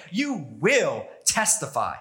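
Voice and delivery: slow, firm, each word weighted; "will" stressed, spoken slowly and with finality; emphatic command. Pretend. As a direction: lay it down as a certainty. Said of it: "Jesus is emphatic".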